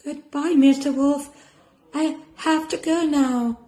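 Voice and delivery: put-on high, polite little girl; cheerful goodbye. Girl saying goodbye to the wolf.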